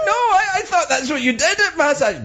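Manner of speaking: high-pitched mock protest; retold indignant squeak; flustered protest. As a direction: squeal it as an embarrassed client, half laughing. Retold naked client's flustered protest.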